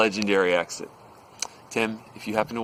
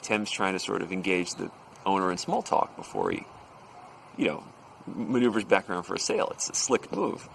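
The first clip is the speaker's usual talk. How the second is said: plain reading voice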